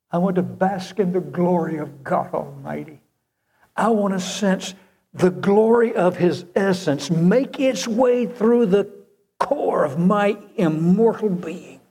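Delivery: fervent pulpit crescendo; preaching at full force. Acted. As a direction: build to the climax with longing. Preaching climax.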